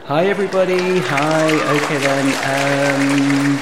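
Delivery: big drawn-out hello to the room; calling to an audience. Pretend. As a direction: project it out to a crowd. Greeting the audience from the stage.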